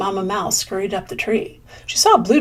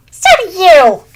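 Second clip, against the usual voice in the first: high, loud snapping comeback; yelled retort; 9 semitones higher, 11 dB louder than usual. Acted. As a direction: fire it right back. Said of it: "yelled Greedy Squirrel".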